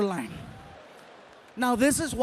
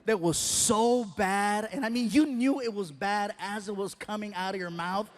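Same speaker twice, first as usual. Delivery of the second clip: ordinary talk, nothing marked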